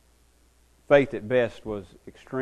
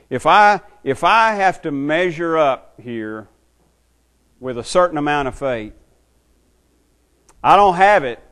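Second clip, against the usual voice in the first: voiced father; 3 semitones higher, 8 dB louder than usual. Preacher voices father's inner thought.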